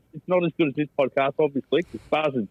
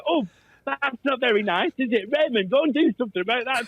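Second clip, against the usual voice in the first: high prim Lancashire mum; a shocked mum, voiced high; mum's voice; 9 semitones higher than usual. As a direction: mum scolding, pitched high. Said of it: "my mum go".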